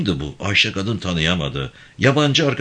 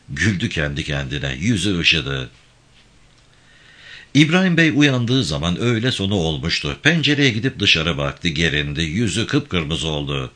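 usual speaking voice

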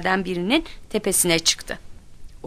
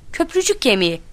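higher, blurted answer; restrained yell, pitch up; mild shout; 6 semitones higher, 3 dB louder than usual. A narrator's reading. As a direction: call out the answer at last. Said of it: "diye bağırdı".

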